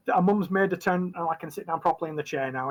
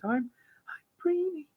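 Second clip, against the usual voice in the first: put-on shy little girl; 12 semitones higher than usual. Shy girl gives her name.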